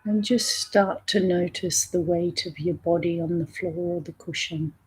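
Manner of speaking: soft, unhurried meditation voice with long pauses; guided meditation. Real, not acted. Teacher settling listeners into a breath meditation, calm and soft. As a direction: gently guide listeners inward, leaving space between phrases.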